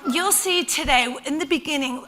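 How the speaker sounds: ordinary talk, nothing marked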